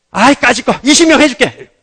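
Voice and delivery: loud, high, offhand giving in; throwing in concession. Retold or imitated. Preacher acting God granting twenty, loud dismissive concession. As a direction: grant it with mock exasperated bravado.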